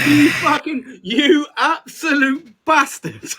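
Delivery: laughing, slow mock outrage; half-cry, half-laugh insult. Real, not acted.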